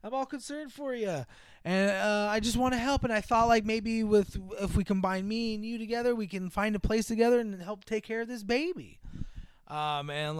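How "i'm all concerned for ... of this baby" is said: higher, sweetly pleading mother; sugary fake mom. Estranged mother sweet-talking her daughter, high sugary plea. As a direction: lay on the fake concern. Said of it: "the mom's like".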